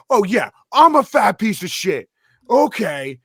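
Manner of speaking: high and loud, mock agreeing; sarcastic shout. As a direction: throw the insult back with sarcasm. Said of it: "he's like".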